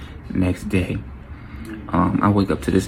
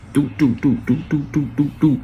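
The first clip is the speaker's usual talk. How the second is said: higher run of "doo" shots; imitated gunfire; 4 semitones higher than usual. Storyteller imitates the shooter's Glock, vocal gunfire. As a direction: mimic automatic fire with the voice.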